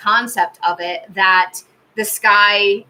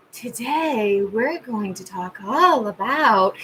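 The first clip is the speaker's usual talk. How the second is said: spaced-out youtuber; 4 dB quieter, much slower than usual. Retold or imitated. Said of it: "she's kinda high".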